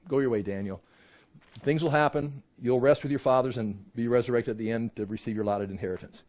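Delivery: low, soft, even delivery; measured, hushed answer from an angel; calm angel. Retold or imitated. Angel calmly answers Daniel's question.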